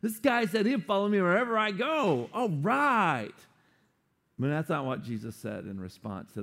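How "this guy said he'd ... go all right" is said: hammed-up high-five cheer; mock celebrating. Imagined Jesus celebrating a new follower, mock excited. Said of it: "you think Jesus would be like".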